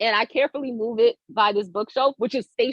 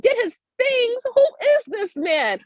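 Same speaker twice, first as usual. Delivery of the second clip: incredulous; 13 semitones higher than usual. Retold or imitated. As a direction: throw it back high in comic disbelief. Incredulous retort to the intruder.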